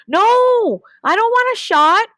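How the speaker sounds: high, loud whiny protest of a puppy; a child refusing a shot, loud and high; crying out. Acted. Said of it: "cries Red Dog".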